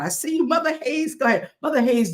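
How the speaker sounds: high delighted call to an elder; light up at seeing her; excited greeting. Real, not acted.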